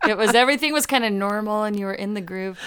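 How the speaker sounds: normal talking voice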